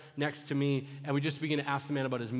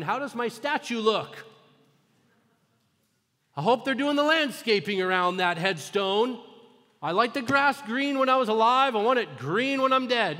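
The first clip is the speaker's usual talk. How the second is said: raised, louder mock whine about the headstone; higher, louder pretend fuss over a statue; mock vain voice; 7 semitones higher, 6 dB louder than usual. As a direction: lampoon someone fussing over their own grave. Mocking a vain dead person's worries.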